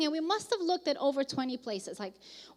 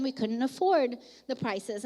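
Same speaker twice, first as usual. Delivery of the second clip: usual speaking voice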